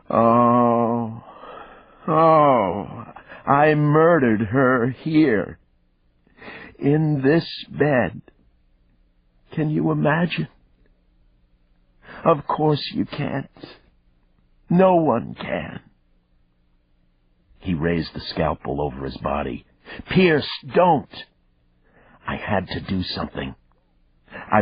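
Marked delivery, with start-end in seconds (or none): 0.1-15.8 high, slow, anguished moan with drawn-out words; a killer lost in grief, keening over the bed. A narrator's reading. Killer confessing to the detective, moaning in anguish. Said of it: "Thomas Pierce moaned". 20.1-21.3 urgent shout. A narrator's reading. Lunging to stop a suicide.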